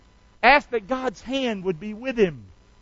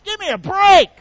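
loud scoffing outburst, pitch jumping up; mock exasperated shout; 9 semitones higher, 10 dB louder than usual. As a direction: throw up your hands and bark it out. Preacher mocks greedy prayer.

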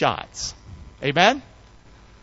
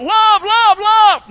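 very high, loud chant; mock crying out; 10 dB louder, much slower than usual. Preacher showing what you'll never see in Bible, high shouted chant. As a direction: belt it out high as a mock angel cry. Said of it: "They cried out".

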